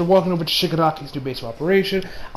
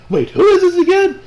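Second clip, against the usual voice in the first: high, louder forgetful question; confused; 12 semitones higher, 5 dB louder than usual. Retold or imitated. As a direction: pitch jumps up on "who is this". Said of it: "He's like".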